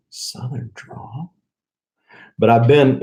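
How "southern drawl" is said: slow, soft, questioning Southern drawl; drawn-out Southern drawl. Retold or imitated. Answering coworkers about his Southern drawl, slow soft drawl. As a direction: stretch it into a hushed Southern question. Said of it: "said something about my Southern drawl, and I go".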